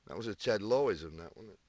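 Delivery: hushed, low snooker commentator murmur; Ted Lowe impression. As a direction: drop into a soft Ted Lowe hush. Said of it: "I did it like Ted".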